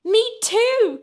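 high, eager exclamation; excited cry. A narrator's reading. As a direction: cry out in delighted agreement. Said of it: "cried Sarah".

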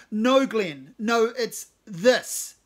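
loud correction. Acted. Cave correcting Glenn angrily.

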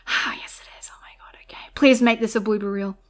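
hushed, breathless pleading whisper. Real, not acted. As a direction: beg under your breath. Whispering plea.

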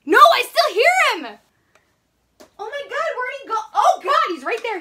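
high, swooping panicked cries; real panic. Real, not acted. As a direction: cry out, losing track of the bug. Hears and sights the cricket again.

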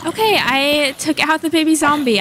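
usual speaking voice

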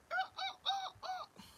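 high squeaky oh oh oh; a jump way up in pitch on each oh; mock surprise. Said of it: "So I'm like".

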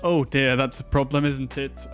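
usual speaking voice

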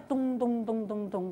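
drawn-out bouncing noises dying away; soft repeated boings slowing to a stop; bouncing ball imitation. Shown as a demonstration. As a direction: mimic a ball settling.

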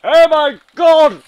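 very high, very loud scream. Real, not acted. Gamer screaming even harder at the crash, shriek. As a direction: scream it at full blast. Sudden shock in game.

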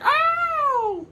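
very high loud squeal; shrill cartoon yelp. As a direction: squeal in fright. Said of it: "Madonna was like".

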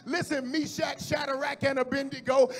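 high, loud, fervent cry; shouting preacher. Acted. Preacher shouting God's promise to the congregation. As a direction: shout it to the rafters.

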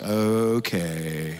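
a weary, deadpan okay; unimpressed wife. Retold or imitated. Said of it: "poor Leanna's like".

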